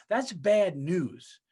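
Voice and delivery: very slow, "bad" pressed hard; slow emphasis. Real, not acted. Closing the story of a murdered friend, slow and heavy. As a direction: drag out "That's bad news" heavily.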